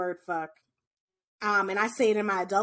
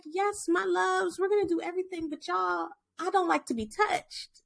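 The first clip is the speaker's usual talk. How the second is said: put-on higher, sugary teacher voice to kids; sweet teacher voice; 7 semitones higher than usual. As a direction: talk sweetly to little ones.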